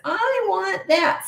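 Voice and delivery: put-on high, slow whining child; whiny voice. Shown as a demonstration. Mom showing her child's whiny demand. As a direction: whine it out like a demanding kid. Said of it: "when you ask like this".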